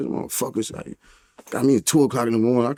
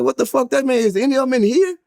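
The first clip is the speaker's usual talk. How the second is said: going off; 12 semitones higher than usual. Retold or imitated. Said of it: "I went off".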